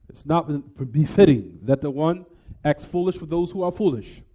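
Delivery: normal talking voice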